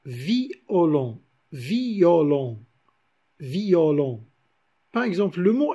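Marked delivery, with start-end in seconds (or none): 0.0-4.2 "violon" repeated as a drawn-out vi-o-lon; each "violon" split after a long "vi"; diaeresis. Shown as a demonstration. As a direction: say "violon" with I and O in separate syllables. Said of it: "on dit violon".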